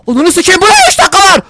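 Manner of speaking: high, loud burst of alarm; panicked shout. Real, not acted. Streamer discovers the bomb's timer, panicked shouting. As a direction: yelp it out in sudden panic. Spots a live bomb timer.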